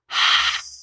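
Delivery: long, airy exhaled sigh; deep sigh. Shown as a demonstration. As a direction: let all the breath out in a sigh. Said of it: "let it all out with a deep sigh".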